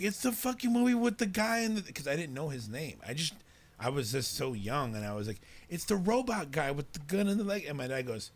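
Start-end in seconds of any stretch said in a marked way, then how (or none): 0.0-1.8 high, loud mock protest; exasperated kid explaining. Kid explaining his drawing to upset parents, mock exasperated. 5.7-7.7 retold frantic explaining. Kid explaining to parents, acted out.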